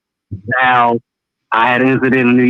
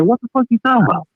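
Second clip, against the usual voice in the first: quick, high-pitched incredulous comeback; retold indignant disbelief; 10 semitones higher, faster than usual. Caller retelling his reply to the New York cop, indignant disbelief. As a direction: act out a baffled protest, pitch jumping up.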